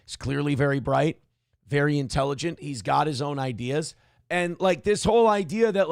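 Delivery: ordinary talk, nothing marked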